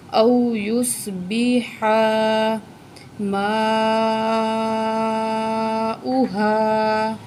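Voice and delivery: Quran recitation, each word drawn out slowly. Shown as a demonstration. Teacher reciting slowly for the student. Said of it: "کھینچ کے پڑھیں گے".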